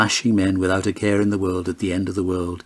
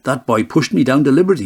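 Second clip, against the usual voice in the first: quick, higher, louder protest; indignant complaint; 5 semitones higher, 5 dB louder, faster than usual. A narrator's reading. Mister Weasley accusing the boy at the door, quick and indignant. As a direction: accuse the boy, huffing and fast. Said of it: "hissing like a gander".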